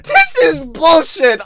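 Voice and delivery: high, loud, drawn-out cry; incredulous outburst. Real, not acted. Reactor rejecting the clip's excuse, high incredulous cry. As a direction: cry out slowly in high-pitched disbelief. Outburst after laughing at clip.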